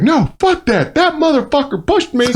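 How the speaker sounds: high, worked-up angry protest; mock-furious rant, high-pitched; retold fury. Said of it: "on a whole nother anger level".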